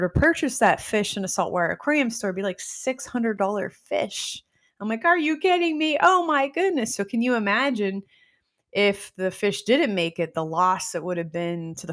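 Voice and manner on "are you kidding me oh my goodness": amazed. Retold or imitated. Amazed reaction to the expensive fish.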